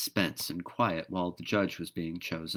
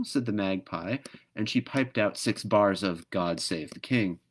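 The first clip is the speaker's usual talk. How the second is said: plain reading voice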